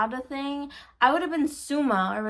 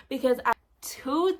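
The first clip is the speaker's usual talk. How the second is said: usual speaking voice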